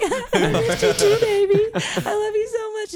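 very high, loud, teary and laughing; overjoyed mom half crying. Retold or imitated. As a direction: choke it out through happy tears. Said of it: "And I'm, like, crying".

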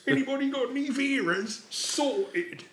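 high, exaggerated Cockney voice; squeaky Cockney geezer; Cockney accent. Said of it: "Those little bits where they go".

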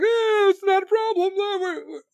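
high, careless brush-off voice; mocking shrug. Mocking the leaders who shrugged off the Afghan exit, high and careless. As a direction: dismiss it in a high lazy mock voice. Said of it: "we're just like".

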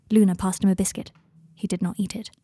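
ordinary delivery

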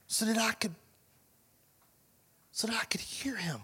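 softly whispered, almost silent. Retold or imitated. Pastor retelling his quiet walk, whispering. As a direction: hush it like you're straining to listen. Walking the park to hear God.

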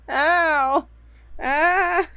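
pained yelps from a cramping foot; sudden high cries of cramp pain. Real, not acted. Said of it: "My foot is cramping".

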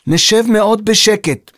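slow, high, emphatic pledge; earnest and deliberate; promising. A narrator's reading. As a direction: spell out the promise for him. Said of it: "הבטיחה ירדן".

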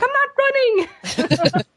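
high, slow squeaky denial; a laughing imitation of a cowardly knight; mock Sir Robin. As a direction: squeal it out nervously while laughing. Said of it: "and he's like".

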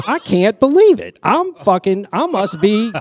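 thrilled that the sheriff knows him; starstruck. Retold or imitated. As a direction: play your own giddy amazement. Retelling his own starstruck thought.

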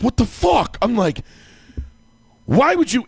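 angry outburst. Retold or imitated. Waking mad at wife.

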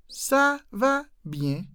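"Ça va bien" slow and high, each word separate. Shown as a demonstration. Teacher breaking down the French answer slowly. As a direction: spell out "Ça", "va", "bien" with pauses.

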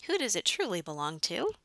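usual speaking voice

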